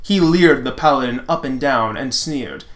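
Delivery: usual speaking voice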